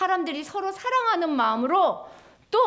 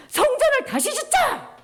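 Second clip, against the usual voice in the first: much higher, louder call to rebuild; rallying cry; 12 semitones higher, 4 dB louder than usual. Acted. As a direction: rouse the crowd.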